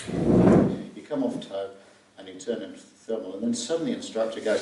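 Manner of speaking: ordinary talk, nothing marked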